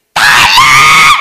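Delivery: a huge, low, full-throated shout of "Tolong"; scream from deep inside. Shown as a demonstration. Preacher showing the scream of hell's dwellers, very loud and deep. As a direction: roar it up from the gut. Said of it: "teriak itu dari sini nih".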